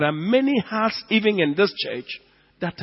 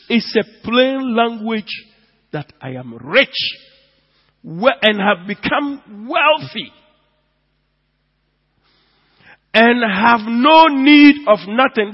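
full-force preaching; 6 semitones higher, 8 dB louder than usual. Acted. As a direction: hammer each word of the verse. Preaching the verse.

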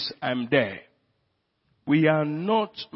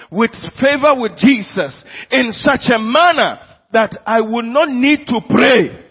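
higher and louder as it builds; climbing fervent preaching; building sermon climax; 5 semitones higher, 8 dB louder than usual. Acted. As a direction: rise to a ringing pulpit peak. Preaching at full force.

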